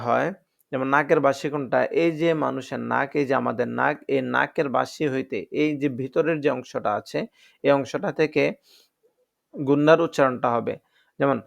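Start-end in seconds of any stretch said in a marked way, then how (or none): none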